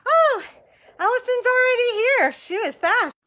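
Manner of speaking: high, loud burst of surprise; delighted gasp at the guest already there; surprised. Real, not acted. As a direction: caught off guard as the guest pops in. Guest arrives unexpectedly early.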